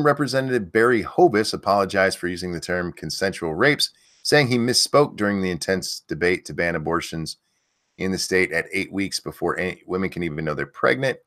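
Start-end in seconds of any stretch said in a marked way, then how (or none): none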